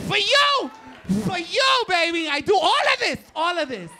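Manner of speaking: high, loud mock declaration from the wife; wife's shouted quote. Wife to husband, shouting her devotion loudly. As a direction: belt it out like the wife's grand claim.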